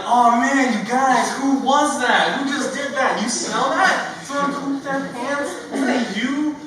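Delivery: high loud fake-innocent kid voice; loudly deflect the blame; playing it off. Boy pretending someone else pooped, high loud fake accusing. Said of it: "trying to play it off".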